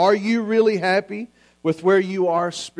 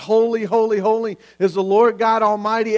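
raised, mildly exclaimed worship cry; retold crying out; 2 semitones higher, 3 dB louder than usual. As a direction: retell the angels' cry with lifted voice. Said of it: "cry out and scream out".

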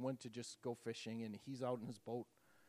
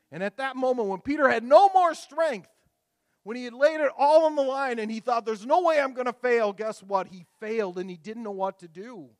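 much higher and louder, pounding each line; fiery sermon peak, nearly shouting; preaching at full force; 11 semitones higher, 19 dB louder than usual. Acted. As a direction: preach it out at the top of your voice.

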